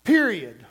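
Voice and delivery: "period" hit hard and dropped low; "period" punched with a final falling tone; emphatic period. Shown as a demonstration. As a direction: land "period" as a heavy full stop. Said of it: "Emphasis on period".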